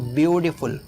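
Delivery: "Beautiful" with a weighty "view"; stress on first syllable. Shown as a demonstration. Teacher models "beautiful", stress on "view". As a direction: lean on "byoo" at the start of "beautiful". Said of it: "starting में ही होता है".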